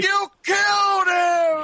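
high, drawn-out shout; mock accusing wail. Co-host blaming the show for a death, mock accusing wail. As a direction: cry out in hammed-up accusation.

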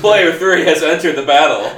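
loud, high game-announcer call; hammed-up arcade announcer; mock game announcer. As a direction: announce a new player with gusto.

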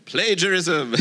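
laughing. Real, not acted. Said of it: "I'm kidding".

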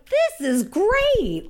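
high, slow gleeful delight; gleeful kid. Retold or imitated. Kid delighting in the class's reactions, gleeful and high. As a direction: savour the chaos with glee. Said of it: "I was like".

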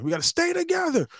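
squeaky high, eager guide voice; hammed-up enthusiastic guide calling the group. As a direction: chirp it up like an overexcited tour guide. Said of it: "with some enthusiasm".

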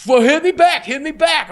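high, goading hit me back; taunting bully. Retold or imitated. Retelling the kid who beat him, mock taunting. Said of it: "And he's just like".

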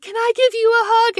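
very high, eager squeak; excited. Retold or imitated. Little sister missionary asking a stranger for a hug, excited squeal.

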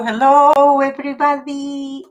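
high and loud single hello. Real, not acted. Host greeting everybody on the stream, high and loud. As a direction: sing out the hello to the room.

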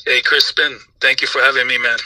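ordinary talk, nothing marked

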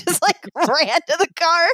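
high giggling through the words; laughing. Real, not acted. Recalling running to the car, giggling. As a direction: laugh while telling it. Laughing at her own fangirling.

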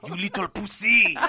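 put-on jokey French accent with a laugh in it; mocking the French in a put-on French accent. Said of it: "Working on my French accent?".

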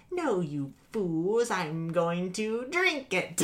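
slow lightly voiced villain; villain drawl. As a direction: savour it like a diva villain. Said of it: "the way she says it".

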